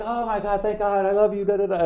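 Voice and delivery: high-pitched, overjoyed fan gush; imitated gushing relief. Fan finally hearing Gears five announced, gushing relief. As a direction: flood with gratitude, trailing into da-da-da. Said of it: "emotional roller coaster".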